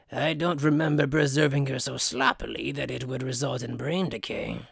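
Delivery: low, sour and contemptuous, with a weary sigh; a cold mad scientist tutting at sloppy work; disdainful scientist voice. Acted. Mayuri scolds, disdainful.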